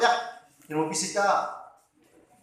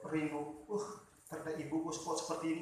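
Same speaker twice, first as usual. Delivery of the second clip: softly voiced grief, a touch of crying; quiet, sorrowful, understated weeping; mild tearful lament; 7 dB quieter than usual. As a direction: murmur it like a boy holding back tears. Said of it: "Jadi menangislah".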